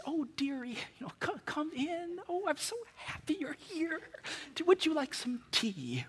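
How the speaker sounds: high, soft, doting granny voice; sweet old lady. Retold or imitated. Old lady welcoming the young seminary visitor, sweet and soft. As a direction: coo it like a kindly grandmother offering tea. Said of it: "the nicest person I ever met".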